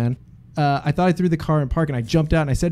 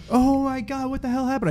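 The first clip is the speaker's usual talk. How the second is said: shocked; 11 semitones higher than usual. Retold or imitated. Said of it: "I was like".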